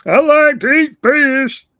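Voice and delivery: high, loud, silly voice; a loud, high cartoon critter; goofy creature voice. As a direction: play a simple-minded critter.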